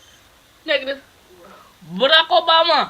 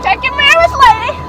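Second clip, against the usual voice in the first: teasing call; 11 semitones higher than usual. Real, not acted. Teasing driver.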